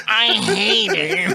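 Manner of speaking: hammed-up whiny complaint; mock Metz voice. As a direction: stretch it into a honking wail. Repeats the Metz impersonation.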